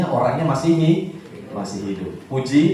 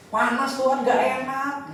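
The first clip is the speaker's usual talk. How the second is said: higher-pitched mock grumble; Ruth complaining; 7 semitones higher, 4 dB quieter, slower than usual. Preacher as Ruth moaning to God about the heat, complaining. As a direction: whinge upward at God, playfully acted. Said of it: "mungkin Ruth bisa aja komplain".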